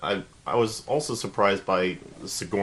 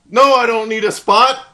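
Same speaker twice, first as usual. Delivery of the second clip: much higher and louder scornful yell; roaring at the soldier who offered help; mock colonel shout; 13 semitones higher, 11 dB louder than usual. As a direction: bellow the refusal like a tough guy. Colonel refusing a spot.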